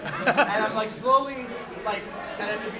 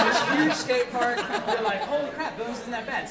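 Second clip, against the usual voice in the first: laughing; 5 semitones higher, 5 dB louder than usual. Real, not acted. Teller laughing through the skate park reveal. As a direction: laugh the words out, higher.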